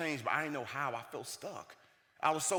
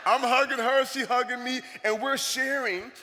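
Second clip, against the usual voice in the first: high, loud mock-tearful narration; hammed-up emotional; 9 semitones higher, 9 dB louder than usual. Retelling the hug with mom, hammed-up emotional. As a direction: keep up the comic overcome wail. Still playing the goofy emotional son.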